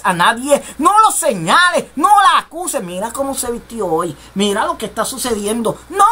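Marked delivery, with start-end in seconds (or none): 2.8-5.8 gossiping judgment. Retold or imitated. Voicing a judgmental church member.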